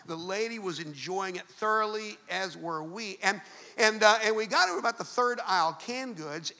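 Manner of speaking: normal talking voice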